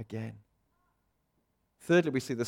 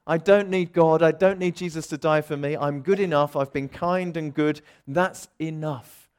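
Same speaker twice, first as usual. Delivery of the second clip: proud boast; 3 semitones higher, 8 dB louder than usual. Retold or imitated. Pride speaking to the congregation, loud and smug. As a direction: puff yourself up as proud. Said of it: "It tells us".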